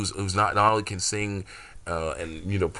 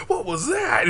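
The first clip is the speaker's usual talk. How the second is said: much higher, hammed-up disbelief; amazed question; slower than usual. Cliff repeating his game line, in high mock surprise. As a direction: ask it like you can't believe the hit. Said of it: "He says that in the game".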